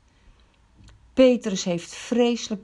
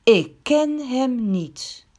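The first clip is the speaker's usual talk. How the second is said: very slow and louder, stress on ken; sworn denial; 4 semitones higher, 4 dB louder, much slower than usual. A narrator's reading. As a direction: swear it, word by word. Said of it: "hij zweert".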